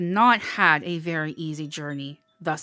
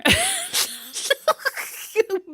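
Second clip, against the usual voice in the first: high bright laugh at herself; amused high-pitched laughter. Real, not acted. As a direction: laugh off the self-promo. Laughing at own self-promo.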